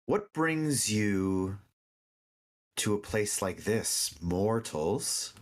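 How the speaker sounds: slow, lightly voiced curious unicorn; a unicorn greeting mortals slowly; gentle unicorn voice. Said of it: "the unicorn voice".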